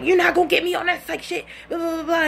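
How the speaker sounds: high-pitched indignant rant; going off. Retold or imitated. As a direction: imitate him ranting, quick and high. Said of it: "He's going off".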